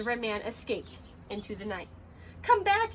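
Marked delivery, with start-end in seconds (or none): 2.4-2.9 high, loud call; yell. Acted. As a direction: shout after him. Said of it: "yelled Ninja Mouse".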